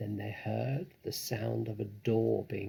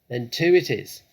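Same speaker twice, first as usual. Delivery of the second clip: loud; 2 semitones higher, 13 dB louder than usual. Acted. Mabel decides aloud.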